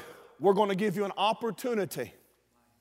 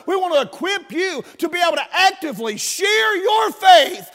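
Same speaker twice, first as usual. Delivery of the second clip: raised, driving pulpit delivery; full-force preaching; 6 semitones higher, 4 dB louder than usual. Real, not acted. Preacher urging church to share their faith, full-force preaching. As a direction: build to a high, loud preacher's shout.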